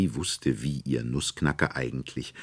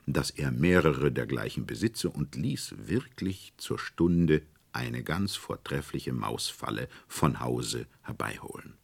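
ordinary delivery, nothing marked

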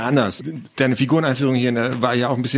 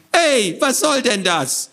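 high, drawn-out indignant creature; squawky protest; 12 semitones higher, slower than usual. Acted. Poked creature complains from the box, squawky protest. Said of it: "beschwerte sich die Stimme".

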